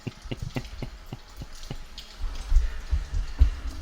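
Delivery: long, high, mostly voiceless laugh; helpless laughter. Real, not acted. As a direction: laugh until the breath runs out. Laughing at own mistake.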